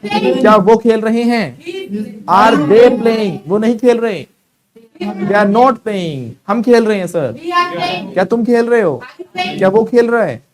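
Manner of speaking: loud, high drill-master calls; projected, energetic classroom drilling. Shown as a demonstration. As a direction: call each sentence out for the class to answer. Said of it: "ऊपर रखो".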